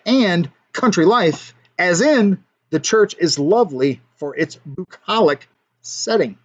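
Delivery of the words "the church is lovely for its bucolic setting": ordinary talk, nothing marked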